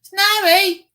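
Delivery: very high, loud put-on Australian ad voice; Foster's advert Australian. Streamer quoting the Foster's beer advert in Australian. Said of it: "where they did a Foster's advert and it goes".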